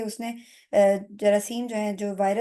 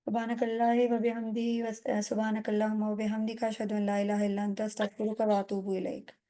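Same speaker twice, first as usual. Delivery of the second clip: prayer recitation; 4 dB quieter than usual. Acted. Closing dua recited.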